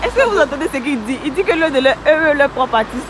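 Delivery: calling out, high and loud; loud outrage. Real, not acted. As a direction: call out to everyone in disbelief. Calls out to audience, outraged.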